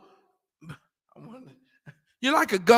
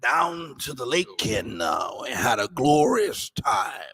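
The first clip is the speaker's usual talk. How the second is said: low, slow pompous preacher voice; slow, lower mock pastor storytelling; theatrical pastor; 8 semitones lower, slower than usual. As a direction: drawl it out like a self-important pastor. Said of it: "it's so theatrical".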